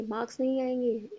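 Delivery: usual speaking voice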